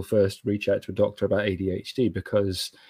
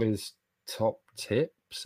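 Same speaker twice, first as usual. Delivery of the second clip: ordinary delivery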